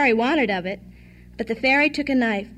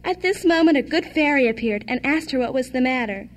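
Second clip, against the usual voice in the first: usual speaking voice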